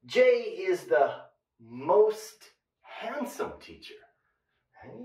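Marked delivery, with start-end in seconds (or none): 0.1-3.9 slow model sentence. Shown as a demonstration. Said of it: "slow model sentence".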